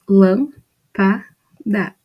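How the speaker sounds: "Lã, pa, da" as three separate syllables, a pause between each; syllables split apart. Shown as a demonstration. Teacher splitting "Lã, pa, da" into syllables for students. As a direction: break "Lã, pa, da" into syllables for the class. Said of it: "A gente vai dividir em sílabas".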